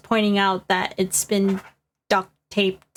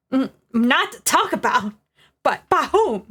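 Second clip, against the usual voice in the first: put-on southern accent; 4 semitones higher than usual. Questioning the witness about secrecy, southern accent. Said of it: "Why did I give him the southern accent".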